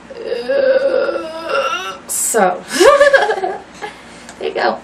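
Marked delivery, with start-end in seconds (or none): 0.1-2.6 tearful. Real, not acted. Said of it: "oh, I'm crying again". 2.7-3.9 high, loud laugh through tears; teary laugh. Real, not acted.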